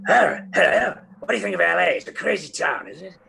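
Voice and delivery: high-pitched impression of an older actor's greeting; John Hurt. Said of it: "he said".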